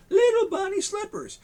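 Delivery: high cooing lady voice; lady gushing over the bunnies. Acted. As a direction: gush like a delighted lady.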